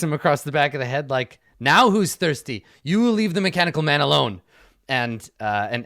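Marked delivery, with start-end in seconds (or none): 1.6-4.4 scolding. Acted. Avi smacks husband, scolds him.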